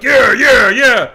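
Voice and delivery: loud, careless yeah-yeah-yeah; mock allies yelling yeah yeah yeah; mock dismissive shout. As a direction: brush him off with a shouted yeah. Allies voiced brushing him off.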